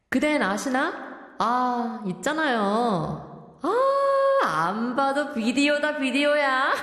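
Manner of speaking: hammed-up corny old-timer, pitched in lively swoops; old-fashioned 늘크크 teacher. Radio host playing 늘크크 reads lyrics aloud, corny old-fashioned teacher. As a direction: read it like a dated, corny-joking character. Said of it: "늘크크 선생님 joining us in the studio reading out".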